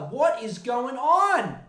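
loud, slow, high what is going on; outraged exclamation. Real, not acted. Reacting to the harassment news, loud and slow.

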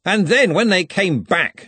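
high, loud, drawn-out jovial telling; slow hearty needling=; threatening joviality. A narrator's reading. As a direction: relish it slowly, with an edge. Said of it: "with the same slightly threatening joviality".

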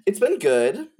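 high, loud burst of cheer; excited. Real, not acted. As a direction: pop up with enthusiasm. Excited answer, big jump.

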